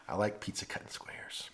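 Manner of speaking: hushed confession of a pizza preference; whispered aside. Acted. Vlogger confides to viewers in a whispered aside. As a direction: whisper it like a little secret.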